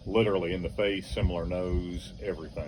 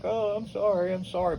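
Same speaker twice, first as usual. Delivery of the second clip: much higher, sing-song fake apology; mock sorry; 12 semitones higher than usual. Driver laughing, fake apology.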